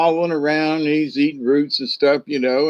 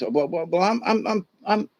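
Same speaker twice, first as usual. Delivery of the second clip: stammering porcupine; 4 semitones higher than usual. Acted. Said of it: "And he goes".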